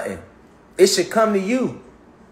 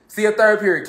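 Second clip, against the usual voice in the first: normal talking voice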